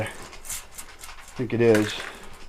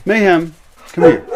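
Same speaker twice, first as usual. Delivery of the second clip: loud, higher call to the dog; 5 semitones higher, 8 dB louder than usual. Real, not acted. Owner calling Mayhem over, loud. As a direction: call out across the yard.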